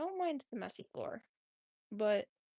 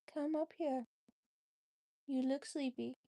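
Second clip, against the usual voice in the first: ordinary talk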